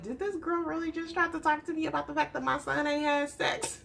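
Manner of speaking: high-pitched mock indignation, breaking into laughs; appalled mom. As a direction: act out a scandalized mother, amused. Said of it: "you can see her face like".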